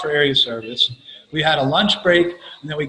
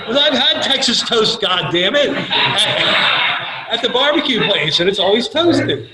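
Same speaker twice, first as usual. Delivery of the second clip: laughing exasperated rant; 7 semitones higher, slower than usual. Retold or imitated. Ranting about Texas toast, laughing.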